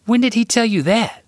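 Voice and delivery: put-on high, loud child voice; amazed boy questioning his brother; surprised little boy. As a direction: Sam asking Jack, astonished. Sam asks Jack, surprised.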